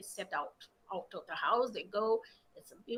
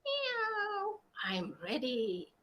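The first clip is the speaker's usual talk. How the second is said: a small kitten calling its mama; 2 semitones higher, 3 dB quieter, much slower than usual. A narrator's reading. Kitten tells mother it's ready.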